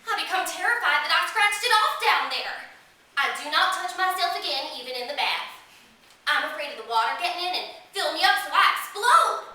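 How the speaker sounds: lightly voiced little girl with lively pitch swings; young girl narrator. Little girl telling the audience her memories, childlike and earnest. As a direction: tell it as a small child confiding her fears. Performs a young girl's monologue.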